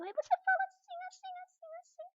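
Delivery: very high squeaky little animal; tiny critter voice. Shown as a demonstration. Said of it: "uma voz de um bichinho pequenininho".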